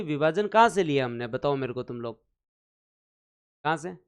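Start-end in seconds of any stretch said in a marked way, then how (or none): none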